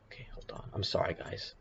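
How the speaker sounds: hushed whispered aside to the viewers. Real, not acted. A streamer whispering an apology to his viewers while the chart loads, whispered.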